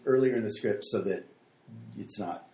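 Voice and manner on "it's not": ordinary talk, nothing marked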